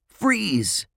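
high-pitched stop order, mildly shouted; yelled command. A narrator's reading. Josh storms in on the twins, yelled command. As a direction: order them to halt. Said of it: "Josh stormed ... and yelled".

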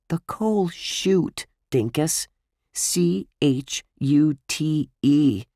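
high, very slow, emphatic correction; exasperated spelling out. Acted. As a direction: spell it out as if to a dimwit. Boy spells it out for friend.

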